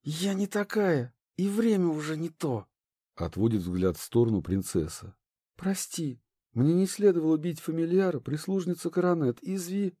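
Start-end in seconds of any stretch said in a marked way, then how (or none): 0.0-2.7 looking away, lightly voiced; subdued princess. A narrator's reading. 5.5-10.0 apologetic princess. A narrator's reading.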